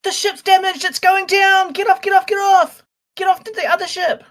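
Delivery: high, loud shout of alarm; urgent yell. Acted. Player as Flynn warns the crew the ship is going down, yelling. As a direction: yell to clear everyone off a sinking ship. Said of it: "So Flynn immediately yells out".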